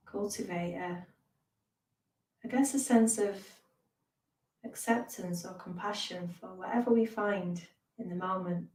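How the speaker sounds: measured meditation voice with long pauses; guided meditation. Real, not acted. Teacher leads beginners through mindfulness and breathing, guided meditation.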